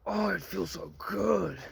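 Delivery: high, slow, drawn-out swooning voice; mock tempted man. Mocking a viewer caught up in lust. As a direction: ham up giving in to temptation. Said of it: "you're gonna be like".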